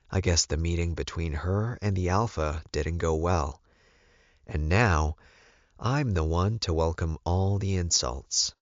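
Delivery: plain reading voice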